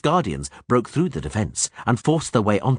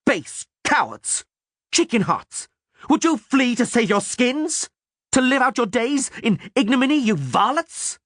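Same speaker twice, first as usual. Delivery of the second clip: scornful roar. A narrator's reading. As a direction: shame them into turning back. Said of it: "roared in a voice Geralt had never heard".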